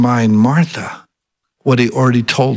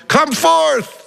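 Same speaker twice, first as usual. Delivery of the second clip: retold yell; 13 semitones higher than usual. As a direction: imitate a far-reaching call, pitched way up. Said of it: "if he would have just yelled".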